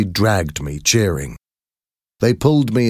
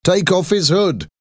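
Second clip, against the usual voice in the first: higher mocking call; calling out from crowd; 12 semitones higher than usual. A narrator's reading. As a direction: egg the crowd on. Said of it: "somebody called".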